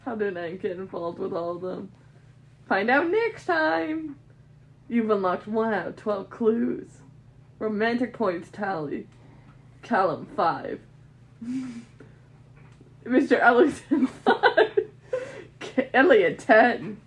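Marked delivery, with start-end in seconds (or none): 2.7-4.2 dramatic teaser. Acted. 11.4-14.9 giggling through the words; laughing. Real, not acted. Laughing while reading scores.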